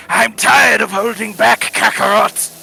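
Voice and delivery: high, loud straining Vegeta scream. Acted. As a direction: belt it like an enraged anime prince. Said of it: "My Vegeta voice is more like".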